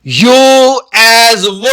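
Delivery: یو ایز و said very slowly, letter by letter; teacher stating the spelling rule; slow spelled-out rule. Shown as a demonstration. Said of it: "جب و کی آواز دیتا ہے".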